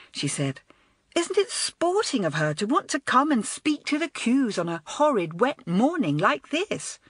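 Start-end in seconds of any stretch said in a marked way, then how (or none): 1.2-7.0 put-on higher, admiring girl's voice; a lightly voiced girl full of admiration; light girlish enthusiasm; enthusing schoolgirl. A narrator's reading. Elizabeth enthusing.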